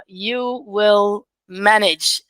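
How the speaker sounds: usual speaking voice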